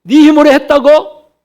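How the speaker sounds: a hint of scornful scolding; mock rebuke. Pastor voicing the scolding God does not give, mock rebuke. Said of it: "그렇게 야단하지 않으십니다".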